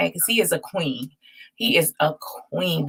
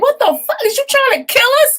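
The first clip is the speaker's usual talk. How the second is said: much higher and louder mock fury, rushed; mad sister, louder; 6 dB louder, faster than usual. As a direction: blurt the sister's outraged squeal fast and loud. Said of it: "she was so mad".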